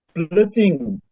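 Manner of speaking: usual speaking voice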